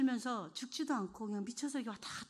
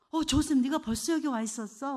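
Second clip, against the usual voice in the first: louder, eager call to a son; joyful greeting; 3 semitones higher, 9 dB louder than usual. Retold or imitated. Mother greeting her son Joseph in a dream, joyful and loud. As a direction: burst out in glad surprise.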